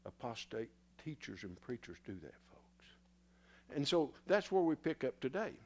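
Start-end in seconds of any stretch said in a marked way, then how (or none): 0.1-2.9 whisper. Real, not acted. Preacher drops voice on key charge.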